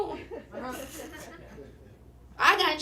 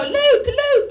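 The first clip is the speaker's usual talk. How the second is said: witch voice; 12 semitones higher, 10 dB louder than usual. Retold or imitated. Witch movie quote voiced.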